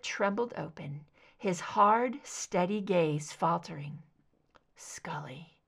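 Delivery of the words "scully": faint breathy whisper of her name. Acted. Mulder, shaken, whispers Scully's name as she turns away. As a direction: barely breathe it, faltering.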